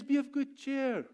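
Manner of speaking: high, sing-out quote at normal volume; cheery Jesus. Retold or imitated. Jesus quoted to the struggling disciples, cheery. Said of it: "He says".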